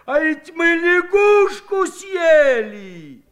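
high, slow, loud shout of disgust; horrified shout. A narrator's reading. Akim shouts to everyone that they ate a frog, horrified. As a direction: bellow the awful truth. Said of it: "воскликнул потрясённый Аким".

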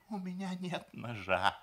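high, plaintive, near-crying voice; tearful. A narrator's reading. Groom failing the surgeon's question, close to tears. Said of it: "сказал жених и заплакал".

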